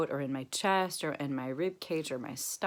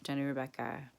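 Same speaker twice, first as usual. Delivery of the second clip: softer, tender words to herself by name; tender self-talk; 5 dB quieter than usual. Shown as a demonstration. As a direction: speak to yourself like a loving friend. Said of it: "Then I say to myself".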